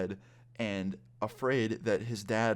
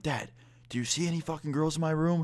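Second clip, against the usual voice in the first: higher, exasperated retold teen protest; indignant protest; 6 semitones higher than usual. Friend arguing with his dad about a girl, indignant. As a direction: imitate a son protesting, voice pitched up.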